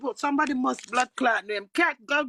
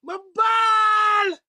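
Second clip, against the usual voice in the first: retold crying; 5 semitones higher, 4 dB louder than usual. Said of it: "And I'm gonna say".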